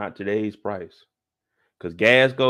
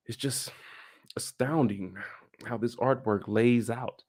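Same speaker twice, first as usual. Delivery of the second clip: soft, measured charmer voice; smooth intellectual; 7 dB quieter than usual. Retold or imitated. Reed admires her artwork, smooth and hushed. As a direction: imitate a hushed, cultured admirer. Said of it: "This man in here talking about".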